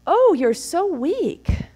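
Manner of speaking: mock taunt. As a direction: goad him, drawn out and needling. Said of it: "eggs him on".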